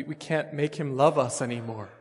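ordinary talk

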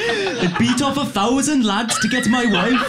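high, slow mock politician boast; hammed-up British impression, pitched high and drawn out; Owen Smith impression. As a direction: call it out slowly in a high put-on voice. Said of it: "me doing an impression of Owen Smith".

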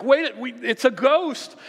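high, loud panicky yelp; hammed-up fright at a ghost; mock terrified cry. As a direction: squeal it like a spooked sailor. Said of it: "They're like".

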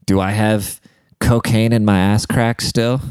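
ordinary talk, nothing marked

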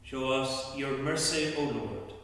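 ordinary talk, nothing marked

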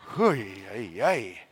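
low, worn-out "oy vey" groan; low-pitched, weary exasperation; exhausted exclamation. Real, not acted. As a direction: sigh it out in comic exhaustion. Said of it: "it's exhausting".

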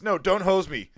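high, loud protest; hammed-up alarmed yelp; mock panicked plea. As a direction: beg off loudly, played for laughs. Acts out pleading reply to threat.

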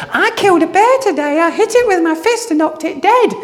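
put-on a kid proudly showing off his kill; boastful boy David.

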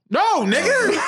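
high, laughing shout of refusal; incredulous high-pitched objection; laughing protest. Real, not acted. Laughing, emphatic rejection in argument.

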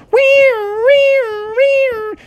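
high wailing police siren; vocal siren noise; siren imitation. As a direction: wail like a siren in long, high whoops.

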